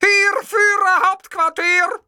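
high, drawn-out full yell; screaming. A narrator's reading. Man shouting into a ringing phone, screaming. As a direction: bellow it slowly at top pitch. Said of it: "schrie ich".

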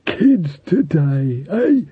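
slow, lightly voiced old skull; drawn-out gossipy skull; skeleton voice. A narrator's reading. Talking skull character.

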